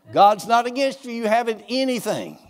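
usual speaking voice

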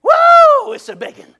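storyteller imitating an excited hollering climber; retold holler. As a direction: whoop it down from a treetop. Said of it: "He hollers down at him".

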